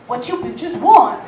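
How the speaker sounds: slow, low sultry man's voice; a hint of a seductive barista; dark sexy voice. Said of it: "in his dark, sexy voice".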